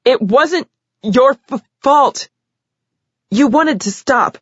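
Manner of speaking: high, upset, near sobbing; tearful confession. A narrator's reading. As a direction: confess through tears.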